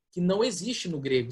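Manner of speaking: normal talking voice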